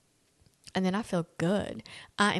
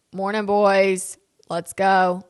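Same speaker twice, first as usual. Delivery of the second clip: loud morning call; 8 dB louder, much slower than usual. Shown as a demonstration. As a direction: boom it out to get the kids' attention. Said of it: "it normally sounds like".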